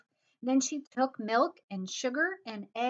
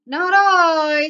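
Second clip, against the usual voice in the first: loud, higher, drawn-out refusal; storybook cat; 6 semitones higher, 16 dB louder than usual. A narrator's reading. Cat refuses to make the cake, loud drawn-out character voice. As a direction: play a cat loudly refusing to help.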